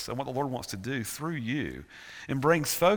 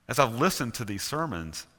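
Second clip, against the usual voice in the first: ordinary delivery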